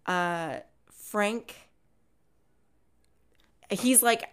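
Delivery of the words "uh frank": normal talking voice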